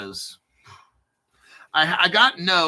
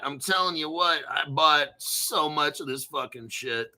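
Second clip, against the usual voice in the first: usual speaking voice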